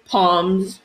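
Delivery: "Palms" for Psalms, P sounded; mispronounced with P. Real, not acted. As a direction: start "Palms" on a P, not an S. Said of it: "I keep saying palms".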